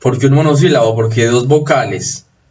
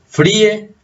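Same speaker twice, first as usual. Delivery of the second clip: Fríe, high, stressed on the í; Fríe with a hiatus, weight on í; stressed closed vowel; 8 semitones higher than usual. Shown as a demonstration. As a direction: say Fríe as FREE-eh, two syllables. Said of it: "Cerrada con acento".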